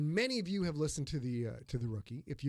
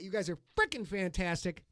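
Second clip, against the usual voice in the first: ordinary delivery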